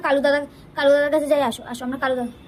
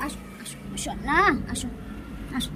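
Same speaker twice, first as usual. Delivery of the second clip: whispered coaxing; 5 semitones lower, 7 dB quieter than usual. Real, not acted. Softly calling pet. (ফিসফিস করে ডাকা)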